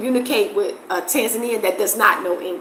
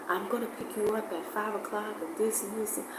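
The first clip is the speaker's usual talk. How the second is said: much softer, almost murmured imitation; speaking so soft; 16 dB quieter, faster than usual. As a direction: mimic a soft-spoken man making plans, quietly. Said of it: "they speak so soft".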